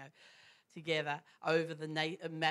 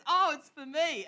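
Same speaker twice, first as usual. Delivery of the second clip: breezy evangelist; 11 semitones higher, 10 dB louder than usual. Retold or imitated. Evangelist claims the call.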